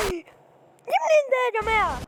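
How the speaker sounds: high-pitched alarmed cry; startled panic. Real, not acted. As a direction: blurt it out in sudden fright. Enemy appears suddenly in game. (بفزع وصوت عالي الطبقة)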